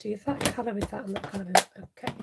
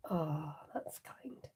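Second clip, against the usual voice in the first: whisper; 6 semitones lower, 14 dB quieter, much slower than usual. Real, not acted. Soft aside to viewer.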